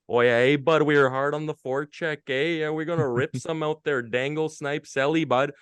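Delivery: put-on breezy Canadian hockey accent, like a junior player at the mic. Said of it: "when we talk about a hockey accent".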